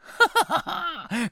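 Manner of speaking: high-pitched mouse giggle. Acted. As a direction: laugh at the scared family. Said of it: "Kacagott Willy".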